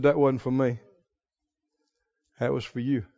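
low and very soft; understated aside. Acted. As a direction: play it right down, no fuss. Jesus downplays the voice.

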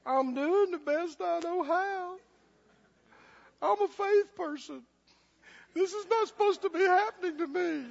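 high, soft, mock-tearful whining; hammed-up poor-me whimper; self-pitying whine. Preacher mimics the weak believer feeling sorry for themselves, mock-crying whine. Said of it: "sit down and cry and feel sorry for yourself".

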